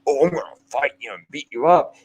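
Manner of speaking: mock tough guy. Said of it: "not go".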